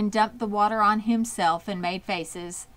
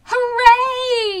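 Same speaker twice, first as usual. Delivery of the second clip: much higher, loud cheering yell; crowd yelling; 10 dB louder than usual. A narrator's reading. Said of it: "yelled the crowd".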